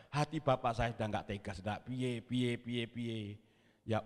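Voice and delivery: usual speaking voice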